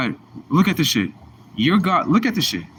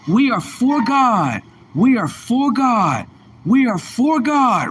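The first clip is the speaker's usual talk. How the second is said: high, slow, swelling to a shout; mocking Christians; 8 semitones higher, slower than usual. Mocking Christians to show how they talk, high and slow then shouting. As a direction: mimic churchgoers chanting their creed, rising to a yell. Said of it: "They say / That's how they talk".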